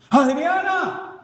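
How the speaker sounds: high, drawn-out cry for a friend; desperate call. Acted. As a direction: stretch the name out, calling for help. Llamando a amigos desde el túnel. (llamado lento y agudo)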